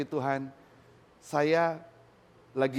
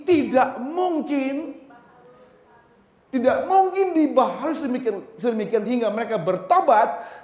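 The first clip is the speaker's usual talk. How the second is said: loud, high, emphatic preaching; full-force preaching; 8 semitones higher, 8 dB louder than usual. Acted. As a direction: preach it out to the congregation with fire. Loud scripture reading.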